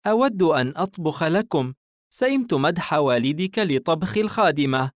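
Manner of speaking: high-pitched, mildly fed-up mother; displeased mother. A narrator's reading. Mother grumbling about praise for the maid, mildly displeased. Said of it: "الأم متنهدة باستياء".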